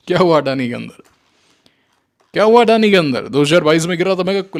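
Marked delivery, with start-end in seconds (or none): 2.3-3.3 higher and louder, leaning on हुआ; louder taunt. Acted. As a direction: push the taunt hard the second time. Repeats the question, pressing harder. (ऊँची, ज़ोरदार ताने भरी आवाज़)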